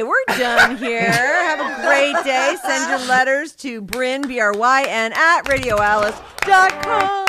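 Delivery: slow, drawn-out announcer outro; radio sign-off. Acted. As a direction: stretch each word like a DJ signing off. Show sign-off to listeners.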